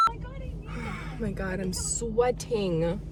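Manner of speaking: exasperated. Real, not acted. Realizes she is sweating.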